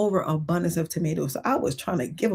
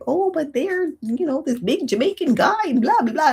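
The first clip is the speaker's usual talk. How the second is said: mocking neighbor; 9 semitones higher than usual. She had the audacity.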